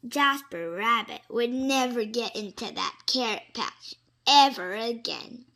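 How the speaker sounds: ordinary delivery, nothing marked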